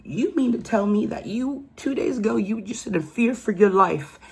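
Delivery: incredulous. Real, not acted. Incredulous rant at the suspect.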